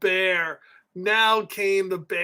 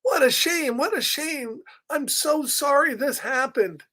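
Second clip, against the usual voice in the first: higher clucking chicken, sympathetic; 5 semitones higher than usual. Acted. Reader voicing the sympathetic chicken. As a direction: play a fussy, kind hen. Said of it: "So the chicken's like".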